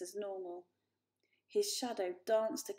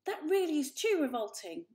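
higher, louder disgust; 7 semitones higher, 5 dB louder than usual. A narrator's reading. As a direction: scold in disgust. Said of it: "hissed Mother".

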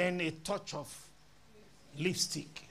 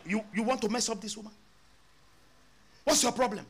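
fast, rising to loud; retold angry challenge; mock confrontation; 6 semitones higher, 5 dB louder, much faster than usual. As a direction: challenge him sharply. Pastor confronts young man.

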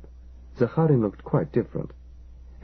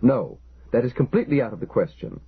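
mild firm refusal, a touch raised; vehement refusal; 4 semitones higher than usual. Acted. Engineer refusing the storekeeper's plea, vehement but mild. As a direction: shut it down hard. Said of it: "the vehemence of Kalichenko's tone".

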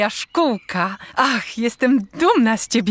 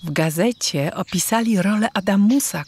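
ordinary talk

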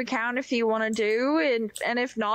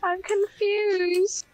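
slow, high sing-song mimicry; drawn-out teasing copy; mocking echo; 9 semitones higher, 3 dB louder, much slower than usual. Echoing the other player mockingly.

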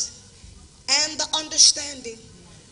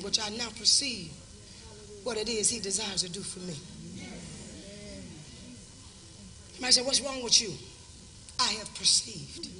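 quiet, measured preacher's confiding; hushed preaching; 10 dB quieter than usual. Real, not acted. Drops to hushed, intimate testimony.

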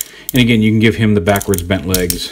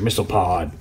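mock battle call after launch noises; play-acted missile launch. Reviewer plays at firing the toy's missiles, mock battle call. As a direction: voice the toy firing its missile pod.

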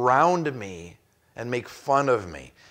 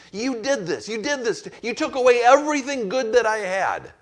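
higher, louder cry of accusation; shouting at God; 9 semitones higher, 4 dB louder than usual. Retold or imitated. Jeremiah lamenting to God, a raised shout. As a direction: hurl the blame straight at God. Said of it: "He's, he's shouting at God".

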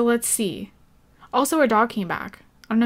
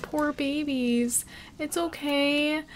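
soothing pets; 4 semitones higher, 5 dB quieter, slower than usual. Real, not acted.

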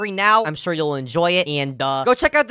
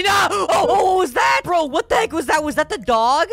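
high frightened shout; startled scream; 9 semitones higher than usual. Real, not acted. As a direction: yell in sudden fright. Jump scare in horror game.

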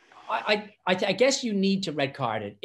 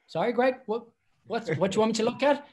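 higher, quick puzzled question; confused referee; 8 semitones higher than usual. Retold or imitated. Referee to a mumbling captain, puzzled high question. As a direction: ask the captain, baffled, what he wants. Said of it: "I was like".

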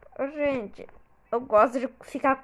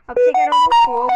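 louder excited babble; loud fast blurt; 3 semitones higher, 12 dB louder, much faster than usual. Real, not acted. Gamer startled by fire, loud fast blurt. Reacts in game.

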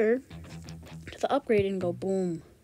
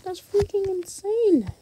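amazed high exclaim; 11 semitones higher, 5 dB louder than usual. Real, not acted. Reacting to high score.

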